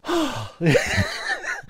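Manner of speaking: high-pitched helpless laugh; helpless laughter. Real, not acted. Podcast host overcome by the gag, laughing helplessly. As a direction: laugh till your sides hurt. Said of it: "my sides are hurting from laughing so much".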